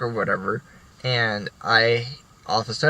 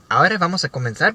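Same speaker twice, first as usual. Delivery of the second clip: quick, higher mock Spanish lecture; teacher speaking Spanish; 7 semitones higher, 4 dB louder, faster than usual. Teacher launching into Spanish to French class, quick and higher. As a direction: rattle off lesson opener. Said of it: "speaking Spanish".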